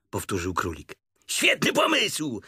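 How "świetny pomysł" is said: high, loud, drawn-out tiger cry; scatterbrained exclaiming. A narrator's reading. Tigger approving the plan, scatterbrained high shout. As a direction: call it out high with delight. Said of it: "zawołał tygrys bardzo roztrzepany".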